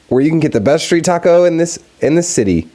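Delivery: announcing a fake taco sponsor, mock ad voice; mock ad read. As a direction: pitch the taco stand like a cheesy radio ad.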